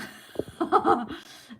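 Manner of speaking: a big real laugh out loud; laughing hard at a viewer's comment. Real, not acted. Laughing at crying with joy.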